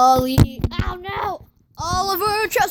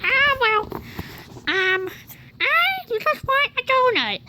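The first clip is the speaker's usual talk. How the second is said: lightly higher voiced dog; 6 semitones higher, 4 dB quieter than usual. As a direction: speak for the dog, a bit higher. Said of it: "what are your thoughts right now".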